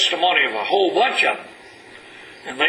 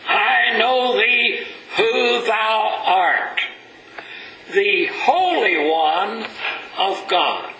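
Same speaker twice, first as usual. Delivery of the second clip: high, slow, dragged-out spirit's plea; unclean spirit pleading; 4 semitones higher, slower than usual. Acted. Preacher voicing the unclean spirit to his church, high and slow. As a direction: stretch each word like a cornered spirit begging.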